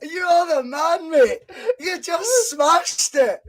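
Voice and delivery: high, laughing praise, slow at the end; laughing delight. Real, not acted. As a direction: praise him through your laughter.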